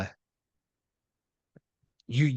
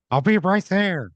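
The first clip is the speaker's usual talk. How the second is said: louder, much higher shout into a phone; calling out; 9 semitones higher, 6 dB louder than usual. Acted. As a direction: call it out, high and loud. Game master voices Michael calling out.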